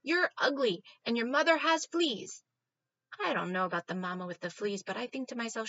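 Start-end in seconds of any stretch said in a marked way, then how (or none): 0.0-2.4 loud, higher schoolyard taunt; taunting yell. Acted. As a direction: yell the insult down from above. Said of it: "yells down to the dog".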